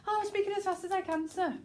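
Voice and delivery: high, hurried protest; very fast. Shown as a demonstration. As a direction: gabble it in a flustered high voice. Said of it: "That's really very fast".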